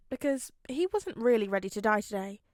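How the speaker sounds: ordinary delivery, nothing marked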